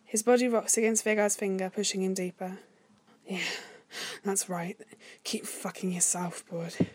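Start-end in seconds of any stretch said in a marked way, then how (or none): 3.3-6.9 soft, hoarse, intimate undertone; hoarse whisper. A narrator's reading. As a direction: whisper it hoarsely, thick with desire. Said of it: "Vegard whispers, voice hoarse from lust".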